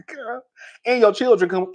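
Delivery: ordinary talk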